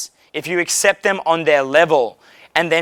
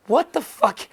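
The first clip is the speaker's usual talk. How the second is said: mock disbelief; 7 semitones higher, 4 dB quieter, slower than usual. Mocking Scientology belief.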